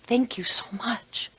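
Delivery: breathless, whispered thank you; a soft whispered thank you, slowed down. Acted. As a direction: hush it out in gratitude. Grateful executive thanks boss.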